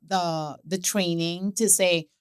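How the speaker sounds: ordinary talk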